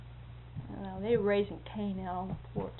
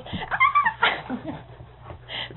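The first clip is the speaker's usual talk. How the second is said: very high mock shriek of children; imitated kids' shriek. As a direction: squeal like kids on a porch. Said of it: "you'd hear this".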